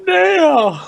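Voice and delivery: gasp, then very high drawn-out scream of the name; mock horrified cry. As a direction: melodramatic high-pitched cry to a fallen hero. Mock cry to fallen Neo.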